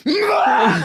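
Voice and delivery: loud, high mock vomit noise; hammed-up high retch; imitated retching. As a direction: act out heaving over the bunk. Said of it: "And then I go".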